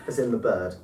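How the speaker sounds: ordinary talk, nothing marked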